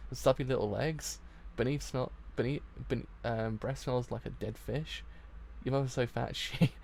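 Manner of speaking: usual speaking voice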